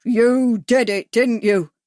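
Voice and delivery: loud, slow accusing shout. A narrator's reading. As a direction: shout it into his ear. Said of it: "shouted into Bags's ear".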